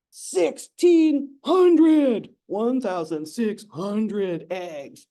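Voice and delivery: gasped, loud and very slow; amazed reveal. Real, not acted. Host reveals how many eggs, loud and slow with awe. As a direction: stretch it out in awe.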